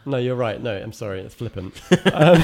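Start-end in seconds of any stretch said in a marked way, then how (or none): 1.8-2.4 laughing. Real, not acted. Laughs after apology.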